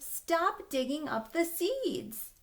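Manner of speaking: firm call. Acted. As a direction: tell the puppy off slowly. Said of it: "Emily Elizabeth shouted".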